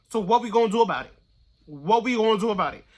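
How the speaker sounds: loud and fast, punching "do" and "gonna"; fired-up rally. Real, not acted. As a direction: rouse them like a pep talk. Rallying the listener to act.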